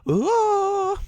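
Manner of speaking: very high, loud squealing ooh; falsetto squeal. Pretend. Gamer squeals after naming the Lake Town archer, falsetto. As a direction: let out a shrill cartoon ooh.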